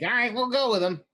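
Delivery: high-pitched goblin voice; goblin underlings agreeing. Acted. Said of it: "They're like".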